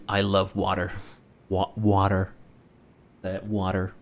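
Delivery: WATER as "wah-der", R sounded; American-style pronunciation. Shown as a demonstration. Streamer practising the word aloud, American-style WATER. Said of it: "I say water and not water".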